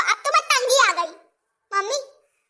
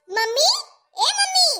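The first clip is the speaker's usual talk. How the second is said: calling for mum, rising higher; 7 semitones higher than usual. Acted. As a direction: call out to your mother in the next room. Daughter calling out for mother.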